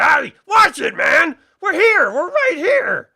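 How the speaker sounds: high, loud startled shouts; panicked orc yelling. Acted. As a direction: scream at the careless archer. Orcs nearly hit by arrows.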